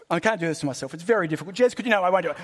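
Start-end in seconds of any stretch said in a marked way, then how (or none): none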